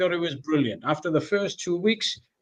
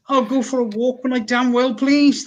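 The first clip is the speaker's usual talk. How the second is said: raised-pitch, mock-indignant defiance; defiant retort; 7 semitones higher, 4 dB louder than usual. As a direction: stand your ground, affronted. Acted defiant retort to neighbours.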